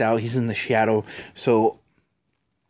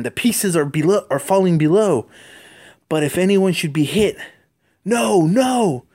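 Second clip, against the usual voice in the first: higher, louder rising panic building to a cry of no; alarmed Spider-Man; 7 semitones higher, 4 dB louder than usual. Acted. Spider-Man cries out as chimney pieces fall, rising alarm. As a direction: hero watching the chimney fall, voice climbing.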